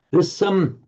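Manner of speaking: ordinary delivery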